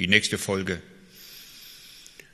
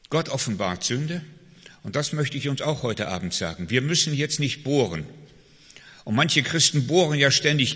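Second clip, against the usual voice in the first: ordinary talk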